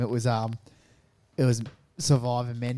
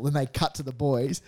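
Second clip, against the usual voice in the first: ordinary delivery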